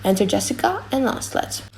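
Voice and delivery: ordinary talk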